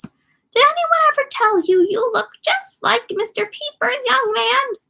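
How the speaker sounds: put-on very high, slow squealing old lady; granny voice. Richie teasing the clerk in a squealing granny voice. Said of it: "squealed in his granny grunt voice".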